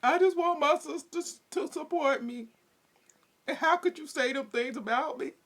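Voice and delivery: high, whiny pretend sob; mock crying. Imitating the crying sister who wants support, high whine. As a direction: whimper it like a wounded sister. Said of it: "she just started crying".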